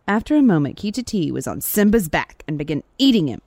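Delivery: lively folktale telling with big pitch swings; animated storyteller; story narration. A narrator's reading. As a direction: tell it like a fable, stressing the key words. Reads a folktale aloud.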